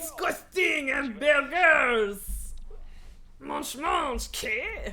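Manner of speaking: slow, hammed-up French accent; shitty French accent. Viewer echoing a burger-hating restaurateur, bad French accent. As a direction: shout it in a mock French accent. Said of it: "influence my shitty French accent".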